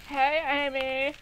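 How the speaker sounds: Nebula greeting. Acted. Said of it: "A female violet blue hedgehog appeared".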